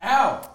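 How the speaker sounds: sharp high yelp of pain. Real, not acted. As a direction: cry out at a sudden hurt. Sudden pain.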